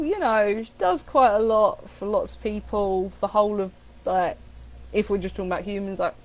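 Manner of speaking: ordinary talk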